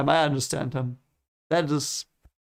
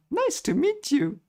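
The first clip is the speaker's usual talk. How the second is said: mock character greeting; 12 semitones higher than usual. Greeting anime character Ho on screen.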